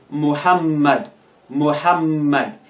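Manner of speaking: "Muhammad" slowly with a held double M; teacher's careful "Muhammad" with shadda on M; doubled M. Shown as a demonstration. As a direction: linger on the middle M of "Muhammad". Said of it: "the two M's are in red".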